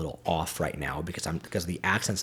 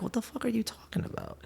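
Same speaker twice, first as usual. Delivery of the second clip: hushed, incredulous mock question; baffled whisper; 8 dB quieter, faster than usual. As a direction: whisper it as a bewildered private thought. Acting out his silent bafflement at slang.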